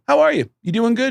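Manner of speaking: high-pitched, over-friendly hello; chirpy retold greeting. Retold awkward greeting to Gene.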